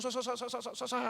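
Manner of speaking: stammering mock child, stuck on the first syllable; imitating a stutter. Pastor imitating his stuttering twin son. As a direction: act out the boy's stutter. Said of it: "anak saya yang kembar itu gagap".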